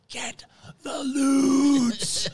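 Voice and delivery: very high, very slow, loud crying yell; drawn-out shout. Retold or imitated. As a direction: stretch every word into a desperate wail. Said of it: "goes up to me and goes".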